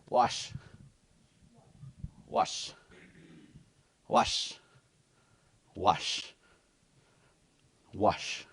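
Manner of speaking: wash repeated slowly, softly; soft repeated wash drill; drilling the syllable. Shown as a demonstration. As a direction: drill wash over and over, quietly. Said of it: "get used to saying this first".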